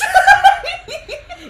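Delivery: loud, high burst of laughter; real squeal of laughter. Real, not acted. Bursts out laughing after answer.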